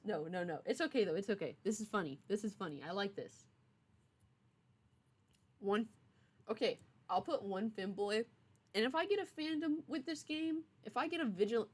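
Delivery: usual speaking voice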